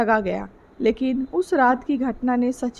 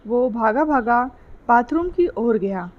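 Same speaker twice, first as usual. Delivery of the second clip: usual speaking voice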